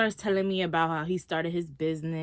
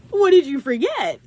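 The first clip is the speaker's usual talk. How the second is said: loud, high-pitched retort; incredulous, raised-voice question; indignant demand; 9 semitones higher, 9 dB louder than usual. Retold or imitated. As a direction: demand it loudly, voice shooting up. Repeats the question, alarmed.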